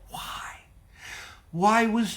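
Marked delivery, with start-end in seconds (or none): 0.1-0.4 a broken, tearful whisper; choked whisper. Real, not acted. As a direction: barely breathe the question, near tears. Preacher grieving Jesus' suffering.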